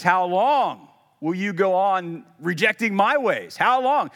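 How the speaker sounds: booming God voice; God's stern challenge. Retold or imitated. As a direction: throw the question back like a challenge. God turning the question back.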